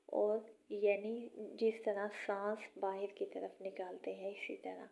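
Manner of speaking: usual speaking voice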